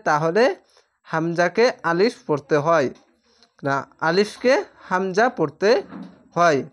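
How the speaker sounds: ordinary talk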